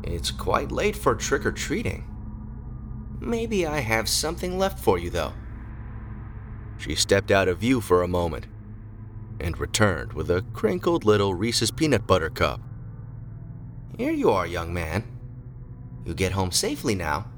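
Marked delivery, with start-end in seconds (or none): 0.0-5.3 put-on high, soft, frail old lady voice; very old woman. A narrator's reading. 14.0-17.3 put-on very old woman. A narrator's reading. Very old lady speaking.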